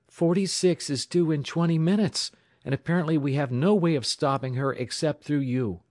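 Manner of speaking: high, pressing explanation; urgent warning. A narrator's reading. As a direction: spell out the emergency urgently. Urgent warning of oncoming train.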